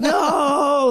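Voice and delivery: long, high wailing no; hammed-up despairing cry; recruit's mock wail. Recruit begging instructor not to call mom, high drawn-out wail.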